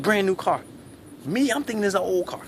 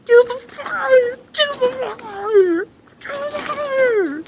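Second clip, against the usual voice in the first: very high, sobbing shriek; crying scream; 5 dB louder than usual. Retold or imitated. Trapped boy crying and screaming through the window. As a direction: wail it through tears. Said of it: "Now I'm crying like a little bitch".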